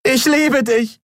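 high-pitched cry of love; desperate declaration across a distance; called out. A narrator's reading. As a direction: call it after him, voice soaring. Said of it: "rief sie".

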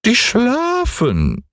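hoch und sehr langsam gerufen; goldener Hahn. Acted. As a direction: stolz herabrufen wie ein Turmhahn. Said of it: "rief der goldene Hahn herunter". (hohe, gedehnte Hahnenstimme)

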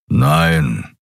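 low rumbling growl of a no; Kero growling. A narrator's reading. Kero growls his denial to the guard, low and irritated. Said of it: "brummte Kero".

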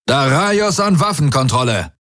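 high-pitched command over the comm; urgent hail. Acted. Commander hails weapons control over comm, urgent hail.

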